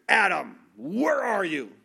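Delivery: loud, frustrated call on the name. Shown as a demonstration. Preacher shows a misread God hunting for Adam, loud and frustrated. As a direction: scold like an exasperated parent. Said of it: "God is just frustrated".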